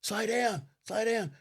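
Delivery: high-pitched frantic order; mock screaming. Woman to driver in speeding car, mock screaming. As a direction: imitate a panicked passenger yelling, without full volume. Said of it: "they're screaming at each other".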